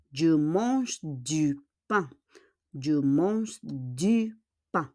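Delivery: "Je mange du pain" said slowly and carefully; slow model sentence. Shown as a demonstration. Teacher slowly modelling a sentence for students. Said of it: "et lundi".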